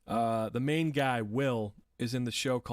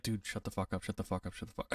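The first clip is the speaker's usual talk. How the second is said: a muttered repeated mantra, softer and softer; under my breath; 5 semitones lower, 7 dB quieter, faster than usual. Retold or imitated. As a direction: grumble it under your breath, fading away. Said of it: "very under my breath".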